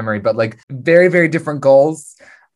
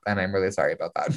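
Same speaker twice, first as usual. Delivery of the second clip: softer, fast throwaway apology; quiet mumble; 8 semitones lower, 10 dB quieter, much faster than usual. Retold or imitated. Boba apologizing to Din for the car, soft and fast. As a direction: rush it out under the breath.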